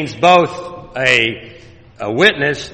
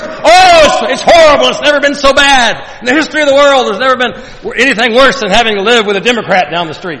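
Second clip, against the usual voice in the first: high, loud mock lament; mock whining complainer; 10 semitones higher, 7 dB louder than usual. Mocking whiny neighbor dreading a Democrat down the street. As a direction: whine it like the world is ending.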